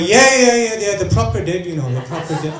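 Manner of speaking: loud, hammed-up insisting that fades down; over-insist, then trail off; insisting friend. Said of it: "He went".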